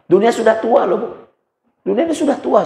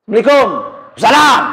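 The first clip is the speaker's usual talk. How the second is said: loud greeting; 4 semitones higher, 3 dB louder, faster than usual. Retold or imitated. Greeting on the street.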